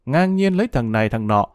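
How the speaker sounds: ordinary delivery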